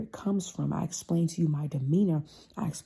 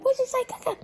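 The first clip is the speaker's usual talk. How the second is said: mock family banter; 4 dB louder than usual. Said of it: "but we laughing loud".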